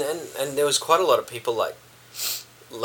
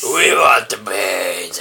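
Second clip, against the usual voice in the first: zombie; 3 semitones lower, 8 dB louder, much slower than usual. Pretend. Zombie bit opening show.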